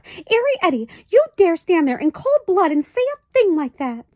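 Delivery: high, loud, scandalized mother; outraged fussy mother; high indignant mother's cry; shocked Homily. A narrator's reading. Homily's voice in the story.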